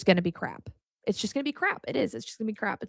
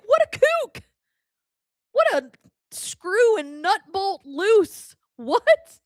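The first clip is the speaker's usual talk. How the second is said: mocking squeak; 10 semitones higher, slower than usual. Mocking her own past self.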